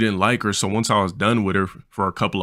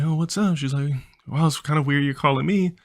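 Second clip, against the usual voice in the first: put-on girl's voice; 6 semitones higher than usual. Voicing the girl on phone.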